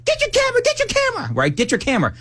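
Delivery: high-pitched imitated screaming. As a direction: squeal it like an excited fan. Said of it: "she starts screaming".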